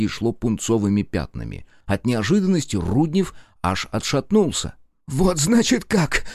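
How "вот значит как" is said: high, slow cracking outcry; voice breaking shout. A narrator's reading. Jealous Nikitin confronting Rudnev, breaking shout. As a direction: blurt it as your voice cracks. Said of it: "срывающимся голосом выкрикнул".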